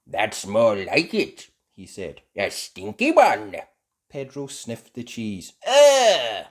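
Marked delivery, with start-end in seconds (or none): 0.1-3.6 loud, slow, satisfied; relishing Grampy. Acted. Grampy Rabbit relishes stink. 5.6-6.4 high, loud recoil; disgusted ugh. Acted. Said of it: "he cried".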